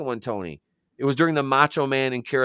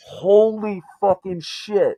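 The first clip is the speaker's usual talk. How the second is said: high, slow amazed gasp of words; stunned; 10 semitones higher, 4 dB louder, much slower than usual. Retold or imitated. Watching the promo on TV, stunned and slow. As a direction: let the shock stretch out high. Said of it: "I was like".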